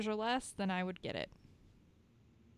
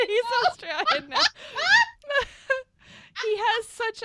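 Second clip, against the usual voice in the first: laughing; 9 dB louder than usual. Real, not acted. Laughing at Aussie [name].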